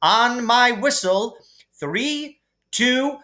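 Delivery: a brisk flying teacher counting off; Madam Hooch counting down. A narrator's reading. Hooch voiced with commands.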